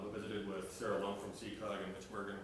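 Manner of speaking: ordinary delivery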